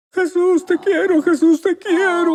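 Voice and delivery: high, loud, tearful cry of love; sobbing shout of devotion in class; crying out to Jesus. Retold or imitated. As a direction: cry it out, overwhelmed and weeping. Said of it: "estaba gritando".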